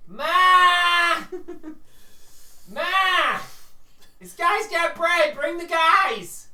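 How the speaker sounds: very high, loud squawking yell; baby cardinal yelling for its mother; child bird shouting. Acted. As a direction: holler for mom like an excited chick.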